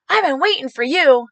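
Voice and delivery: high, loud excited man's greeting, imitated; eager supporter. Supporter leaning across his truck to the candidate, eager. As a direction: imitate a fan thrilled to meet you.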